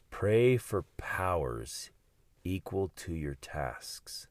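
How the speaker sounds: slow, deliberate quotation; solemn recitation. Acted. Speaker quoting Phillips Brooks to listeners, slow solemn reading. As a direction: read it like scripture, slowly.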